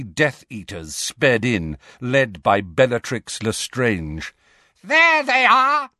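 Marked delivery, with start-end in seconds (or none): 4.8-5.9 loud, high, drawn-out screech; shrieked. A narrator's reading. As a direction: shriek it out slowly in triumph. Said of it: "she shrieked".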